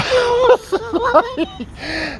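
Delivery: sudden high-pitched laugh. Real, not acted. Vlogger overcome mid-rant, high laughing.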